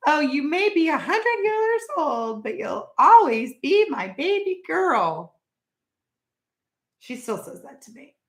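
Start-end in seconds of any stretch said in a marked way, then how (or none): 0.1-5.3 higher, slow doting mother; imitating mother. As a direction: lightly voice an adoring mom. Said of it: "my mother would always say".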